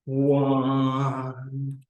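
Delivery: "one" held long in a single flow; one flowing count. Shown as a demonstration. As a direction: stretch "one" out as one continuous sound. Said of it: "going as a flowing motion".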